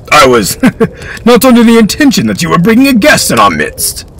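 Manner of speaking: high, loud voice with a dry laugh; dry lord. Acted. Beckett noticing the uninvited son, high dry tease. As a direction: tease the guest dryly. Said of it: "smiled dryly".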